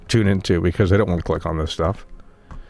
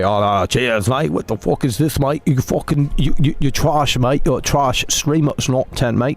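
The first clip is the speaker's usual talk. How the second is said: angry British troll in a hammed-up British accent, higher; mock British accent; 4 semitones higher than usual. Streamer imitating British chatters attacking him, in a mock British accent. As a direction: rant like an irate British viewer in a mock British accent. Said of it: "and they'll say".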